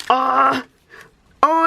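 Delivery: usual speaking voice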